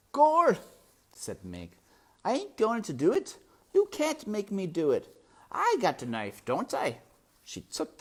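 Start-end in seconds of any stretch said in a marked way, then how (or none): 0.1-0.6 very high, loud exclamation; exclaiming servant girl. Acted. 2.2-7.0 put-on high, loud, defiant girl; defiant servant girl.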